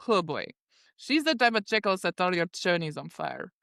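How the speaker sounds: character lecture in a put-on Russian accent. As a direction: scold Carl in a mock Russian accent. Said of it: "I should be doing this in my Russian accent".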